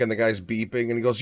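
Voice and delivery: usual speaking voice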